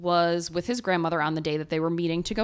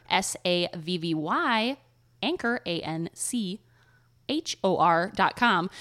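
slowly spelled out; slower than usual. Shown as a demonstration. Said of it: "spelling anchor is harder than it looks".

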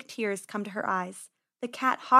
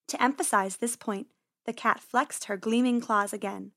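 usual speaking voice

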